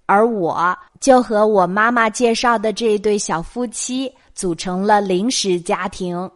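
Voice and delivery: ordinary delivery, nothing marked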